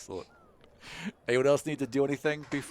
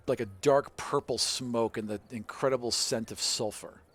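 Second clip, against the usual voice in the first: ordinary talk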